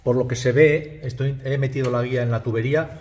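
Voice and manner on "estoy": ordinary talk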